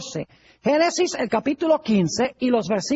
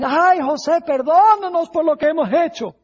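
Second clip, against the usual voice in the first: higher, louder imitation of weeping brothers; mock tearful plea; 6 semitones higher, 4 dB louder than usual. Joseph's brothers beg his forgiveness, mock tearful plea. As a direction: plead loudly, as if crying, without real tears. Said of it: "empiezan a llorar y dicen".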